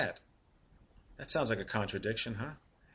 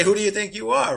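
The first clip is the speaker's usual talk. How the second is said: indignant retort; 7 semitones higher, 6 dB louder than usual. Retold or imitated. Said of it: "they'll say".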